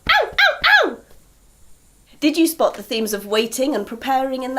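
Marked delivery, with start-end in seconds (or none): 0.1-1.0 high, squeaky monkey yelps; little monkeys calling, high. Acted. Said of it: "And little monkeys go".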